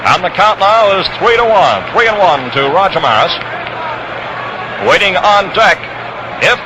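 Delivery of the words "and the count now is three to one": normal talking voice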